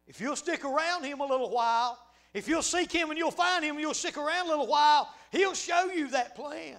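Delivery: high, loud, insistent sermon delivery; full-force preaching. Real, not acted. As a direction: drive the promise home at full voice.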